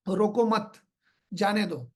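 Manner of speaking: रोको मत as one phrase, pause, then जाने दो; pause after मत. Shown as a demonstration. Trainer showing how a comma changes meaning, pause after मत. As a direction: break after मत, let जाने दो fall. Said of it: "मैं बोल कैसे रहा हूँ".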